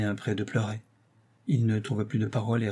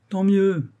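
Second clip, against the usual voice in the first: high, loud, gleeful comeback; laughing retort; 10 semitones higher, 6 dB louder than usual. A narrator's reading. Actress to her bitter lover, amused. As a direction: toss it back gaily, pitched way up. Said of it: "repartit la comédienne en riant".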